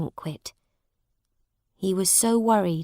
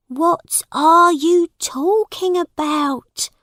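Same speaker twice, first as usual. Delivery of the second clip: high, slow, loud questioning; worried mother asking; 9 semitones higher, 6 dB louder, slower than usual. A narrator's reading. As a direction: ask in a high, anxious mother's voice. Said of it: "asked Missus Canty".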